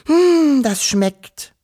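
put-on girl savouring jam with a sigh; contented sigh. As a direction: sigh happily over the jam. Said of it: "seufzte Wischel zufrieden".

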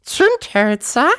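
very high, slow squeaky question; piepsende Großmutter. A narrator's reading. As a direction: squeak the word out like a baffled granny. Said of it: "piepste die Wawuschel-Großmutter". (hohe, gedehnte Piepsstimme)

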